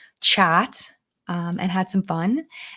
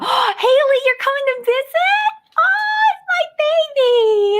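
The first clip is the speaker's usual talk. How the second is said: excited pet greeting; slower than usual. Real, not acted. Host greeting her dog Haley on camera, much higher and excited. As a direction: squeal over the puppy arriving.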